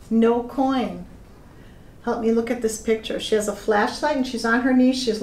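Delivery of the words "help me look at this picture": usual speaking voice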